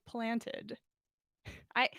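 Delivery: ordinary delivery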